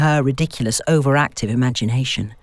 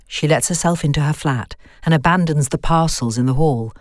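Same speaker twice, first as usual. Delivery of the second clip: ordinary delivery, nothing marked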